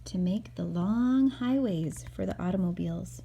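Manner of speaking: gentle storybook narration read aloud; calm read-aloud for a little boy; reading to a child. Acted. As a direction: read it like a bedtime picture book. Mother reads picture book aloud.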